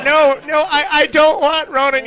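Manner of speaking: hammed-up fearful protest; panicked protest. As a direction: beg frantically in mock terror. Mock panic refusal.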